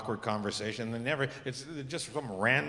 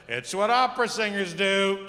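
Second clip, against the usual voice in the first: slower, higher know-it-all voice, each word laid out; exact imitation of the guy; 6 semitones higher, 4 dB louder than usual. Repeating the guy's smug advice, slow and higher. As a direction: copy his smug tone word for word. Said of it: "that's exactly how he said it".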